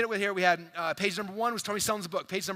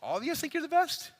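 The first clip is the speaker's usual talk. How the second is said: mock disbelief; 8 semitones higher than usual. Real, not acted. Teasing crowd who all raised hands.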